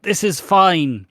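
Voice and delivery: high, slow and loud mock calm; mock reassurance. Stacey reassuring Martin under the beam, mock reassurance. Stacey's retold denial of danger.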